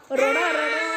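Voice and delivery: hammed-up wail to show the class; mock crying. Shown as a demonstration. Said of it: "मोठ्याने रडणे".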